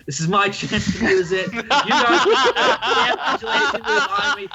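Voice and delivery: high, giggling through the words; laughing. Real, not acted. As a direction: laugh while gloating. GM laughing at his own move.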